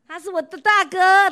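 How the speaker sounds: loud, high, slow affectionate quote; fond big-brother quote; quoting a fond believer. Retold or imitated. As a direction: proclaim it like a proud little brother. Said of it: "人家说啦".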